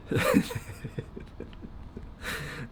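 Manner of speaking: a short self-conscious laugh; embarrassed laugh. Real, not acted. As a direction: laugh softly at yourself through tears. Laughs at own emotional moment.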